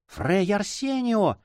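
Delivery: high, drawn-out cry of recognition. A narrator's reading. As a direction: call the name out high and slow. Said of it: "вскричала Донна Клара". (потрясённый вскрик)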